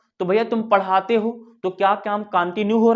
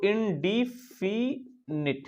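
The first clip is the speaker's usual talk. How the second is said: Indefinite drawn out slowly and softly, part by part; slow dictation; 8 dB quieter, much slower than usual. Shown as a demonstration. Teacher writing the tense name for students, slow dictation. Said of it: "पहले इसको लिख लेने दो".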